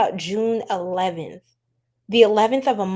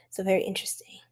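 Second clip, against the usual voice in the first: whisper; 2 semitones lower, 8 dB quieter, faster than usual. Real, not acted. Quiet aside after reading.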